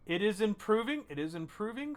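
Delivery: the healer delighted the patient is recovering. Acted. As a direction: burst out with relief at the news. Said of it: "He goes".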